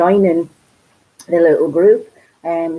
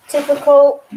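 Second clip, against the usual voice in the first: exasperated; 8 semitones higher, 6 dB louder than usual. Real, not acted. Finds lost item behind radiator.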